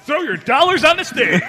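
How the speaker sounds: loud, high club hype; hammed-up plea to the tippers; stripper DJ announcer. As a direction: shout it over the club speakers. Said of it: "stripper D J announcer".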